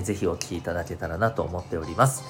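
ordinary talk, nothing marked

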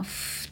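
ふっ as bare F, no vowel; English F sound. Shown as a demonstration. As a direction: say ふっ as a voiceless F hiss. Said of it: "そのFを作るこの".

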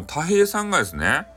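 ordinary delivery, nothing marked

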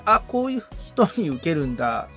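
normal talking voice

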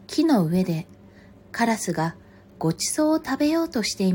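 projected storybook reading, phrase by phrase; story narration. A narrator's reading. Reader telling a fox and crow fable, story narration. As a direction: read aloud as to children, with pauses. Reads a picture book aloud.

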